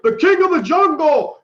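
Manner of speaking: loud, very high proclamation; hammed-up showman announcement. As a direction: shout it out like presenting a monster on stage. Acting out King Kong's stage presentation.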